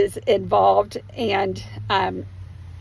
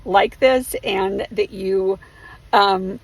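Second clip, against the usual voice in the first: ordinary delivery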